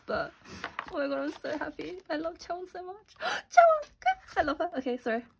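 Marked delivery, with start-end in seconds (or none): 0.9-3.2 high, softened fangirl gushing; getting so excited. Real, not acted. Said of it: "I'm getting so excited". 3.2-4.7 very high, loud fangirl squeal; getting so excited. Real, not acted. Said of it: "I'm getting so excited".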